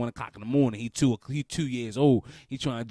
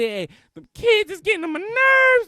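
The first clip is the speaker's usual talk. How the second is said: high, loud teacher's complaint; hammed-up venting at full voice; exasperated yell; 8 dB louder than usual. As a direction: holler it like a fed-up teacher. Acts a teacher venting about kids.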